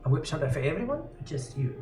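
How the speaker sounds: lightly voiced old barkeep, hesitant; a hint of a fussy old innkeeper; old woman voice.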